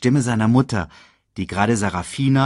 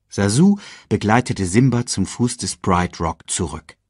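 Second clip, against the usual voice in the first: ordinary delivery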